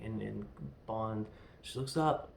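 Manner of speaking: ordinary talk, nothing marked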